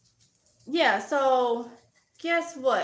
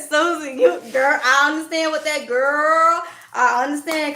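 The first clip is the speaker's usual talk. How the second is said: excited agreement; 2 semitones higher, 6 dB louder than usual. Real, not acted. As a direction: burst out in real fellow feeling. Laughs, exclaims to a viewer.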